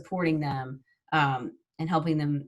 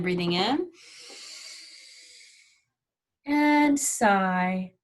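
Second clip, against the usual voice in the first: guided breathing cue; much slower than usual. Shown as a demonstration. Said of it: "Let's do it again".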